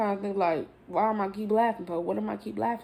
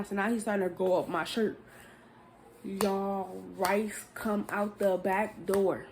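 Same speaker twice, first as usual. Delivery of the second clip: normal talking voice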